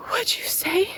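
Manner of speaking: shocked, asking her to repeat; stunned whisper. Retold or imitated. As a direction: whisper it in disbelief. Stunned at stranger's words.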